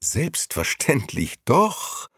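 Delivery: slow, icy, smiling reply; icy smile. A narrator's reading. The ninth speaker correcting a colleague, icily smiling. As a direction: savour the words with cold amusement. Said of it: "lächelte eisig".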